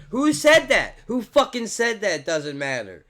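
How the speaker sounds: high, loud indignant demand; angry outburst. Real, not acted. Vlogger fires back at critics, loud and angry. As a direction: shout it back, outraged.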